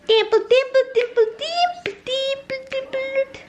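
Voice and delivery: very high, sing-song calling of the pet's name; high dog-calling singsong. Real, not acted. Owner calling her dog in a high singsong. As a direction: chant the dog's name up high and loud.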